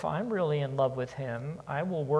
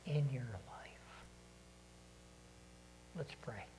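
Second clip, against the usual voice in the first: a soft whispered ending; 13 dB quieter than usual. Real, not acted. Preacher ends the appeal in a whisper. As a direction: let it fade into a whisper. Closing question, hushed.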